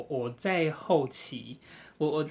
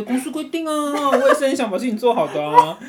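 high, loud plea breaking into laughter; exasperated protest; 6 semitones higher, 9 dB louder than usual. Retold or imitated. Agent defends following company rules, exasperated and laughing. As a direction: protest with mock helplessness, voice climbing.

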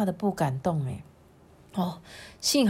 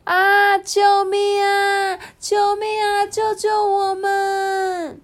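very high, drawn-out wailing calls; crying for help; 11 semitones higher, 3 dB louder, slower than usual. Acted. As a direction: stretch each plea out high over the sea. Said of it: "听到了菲菲跟比比在海上的呼". (高声拖长地呼救)

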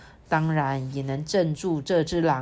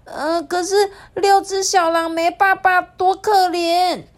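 high, loud tearful plea; pleading; 12 semitones higher, 8 dB louder than usual. Acted. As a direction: plead for the poor cubs. Fox fairy pleads for cubs.

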